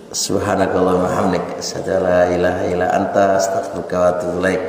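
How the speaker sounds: low, soft recitation of the closing prayer. Real, not acted. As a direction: recite the closing dua softly and quickly. Doa penutup majelis. (doa penutup dibaca pelan dan lirih)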